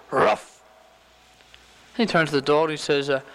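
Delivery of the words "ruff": a short bark of a word; talking dog. Acted. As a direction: say it as a dog's woof. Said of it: "And the dog says".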